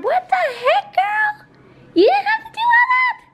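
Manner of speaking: very high, gushing squeal; excited squeal. Real, not acted. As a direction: squeal it like you can't believe it. Overwhelmed by a gift card.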